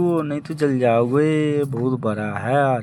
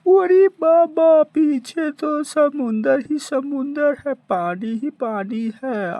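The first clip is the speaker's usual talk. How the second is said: panicked cry; 11 semitones higher, 4 dB louder than usual. Acted. Stranded by the sea, panicking.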